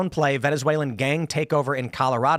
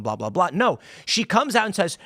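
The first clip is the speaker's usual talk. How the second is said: usual speaking voice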